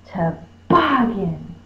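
slow toboggan, BOG hit hard; mock scolding stress. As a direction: fuss at a dog: tuh-BOG-gan, middle syllable punched. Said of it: "I'm fussing at him".

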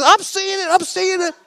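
very high excited squeal; amazed. Acted. Anderson watches his own knee shrink, squealing in amazement. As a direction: squeal it back in disbelief. Healed man answers in amazement.

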